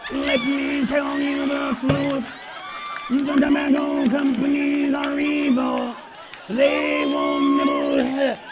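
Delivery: flat mechanical buzzing monotone; monotone robotic voice box; robotic throat-box voice. Retold or imitated. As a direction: speak through an electrolarynx. Mimics electrolarynx voice, robotic monotone.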